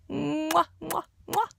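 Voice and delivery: loud, high smacking kisses; blown kisses. Real, not acted. Child ending a Mother's Day message with loud high kisses. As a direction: blow three kisses at the camera.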